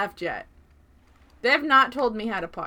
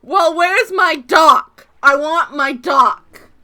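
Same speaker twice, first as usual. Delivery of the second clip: whiny demand; 5 semitones higher, 10 dB louder, much slower than usual. Real, not acted. Childish demanding.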